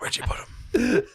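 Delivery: high, slow laughing ask; laughing question. Retold or imitated. Pressing the guide, high and laughing. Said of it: "I was like".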